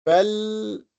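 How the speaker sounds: "فالتقمه" as fal-taqamahu, L held long and high; pronounced lam. Shown as a demonstration. Teacher giving an example verb, lam fully sounded in "فالتقمه". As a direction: sustain a clear L in "فالتقمه". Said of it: "you have to pronounce the lam".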